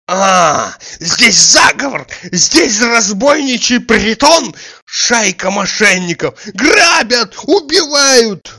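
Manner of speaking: high, loud wail building to a scream; hysterical outcry. A narrator's reading. Tarantiev crying conspiracy and murder, hysterical screaming.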